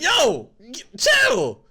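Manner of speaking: high, loud yell of chill. Retold or imitated. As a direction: shout it out to calm him down. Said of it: "I be like".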